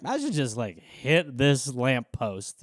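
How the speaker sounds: low, slow, idle musing; intrusive inner thought. Acted. Driver's brain idly suggesting a crash, low and slow. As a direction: let the bad idea ooze out slowly and low. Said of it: "you'll just be like".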